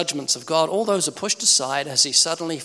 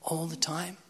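whispered all the time; inner thought whisper; 10 dB quieter than usual. Real, not acted. Thinking to himself after Fred's answer, whispered in amazement. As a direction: breathe it to yourself in amazement. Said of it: "I think".

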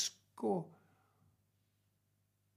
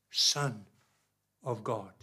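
normal talking voice

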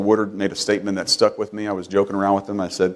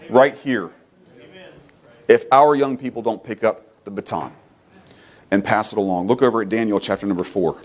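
usual speaking voice

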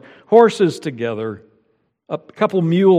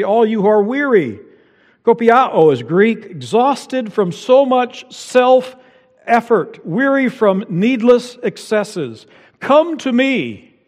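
higher and louder, slowing on key words; preaching at full force; 7 semitones higher, 8 dB louder than usual. Acted. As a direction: call out the invitation from the pulpit. Pastor preaching the invitation.

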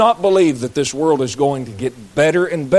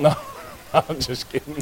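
laughing it off; 7 dB quieter than usual. Real, not acted. Laughs off joke about wife.

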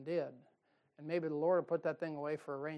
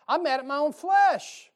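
slow, much higher, full-throated shout; shouted climax; 8 semitones higher, 11 dB louder than usual. Real, not acted. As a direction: hit the peak of the sermon. Climax of angry preaching.

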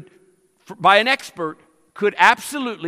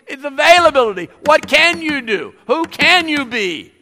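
higher and louder, driving the point; preaching emphasis; 8 semitones higher, 7 dB louder than usual. Real, not acted. Preacher rousing congregation, loud rising emphasis. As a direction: push it out with rising fervor.

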